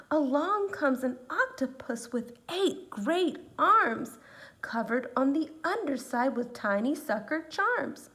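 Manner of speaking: put-on lively read-aloud to children; story reading. A narrator's reading. Teacher reading a picture book to her students, lively. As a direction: read it like a storybook for little ones.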